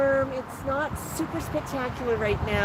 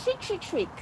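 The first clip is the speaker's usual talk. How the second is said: quick mock shrieks, softly done; light imitation of a screeching bird; imitated bird shrieks; 9 semitones higher, 4 dB quieter than usual. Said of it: "Mango just woke up with a shriek".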